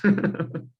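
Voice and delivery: high burst of laughter; short high laugh. Real, not acted. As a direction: laugh out at the joke. Laugh after joke.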